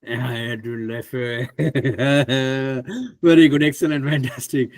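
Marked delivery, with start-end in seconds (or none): 0.3-1.5 slow, softer attempt at Lefebvre as "luh-FAY"; imitated pronunciation. Host copies Kim's pronunciation, slow imitation of Lefebvre. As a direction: echo Edwin Lefebvre slowly, trying out "luh-FAY". Host repeats the demonstrated name.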